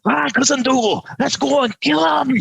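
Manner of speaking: high-pitched hammed-up war cry; rough Highlander. Connor rallies Cousin Dougal to the fight, mock war cry. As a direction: answer like a rough Scot raring for battle. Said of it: "They'd have been up for it".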